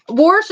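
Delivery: "Warsh" said with an R before the sh; regional pronunciation. Shown as a demonstration. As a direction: put an R into "Warsh". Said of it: "They say Warsh down here too".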